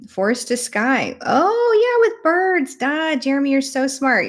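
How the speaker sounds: usual speaking voice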